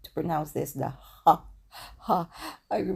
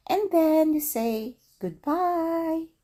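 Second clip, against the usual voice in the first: bright sign-off; 11 semitones higher, 4 dB louder, slower than usual. Real, not acted. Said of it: "teacher closing".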